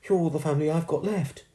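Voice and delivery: high-pitched, heartfelt appeal; pleading. A narrator's reading. Girl tells ghost he's her only family, pleading. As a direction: plead as a child facing loss. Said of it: "she pleaded".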